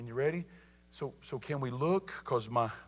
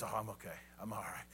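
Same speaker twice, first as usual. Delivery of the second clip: low, hushed, nonchalant reply; unbothered dad; 5 semitones lower, 10 dB quieter, faster than usual. Retold or imitated. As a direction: play down the pain, soft and low. Dad shrugs off electric shock.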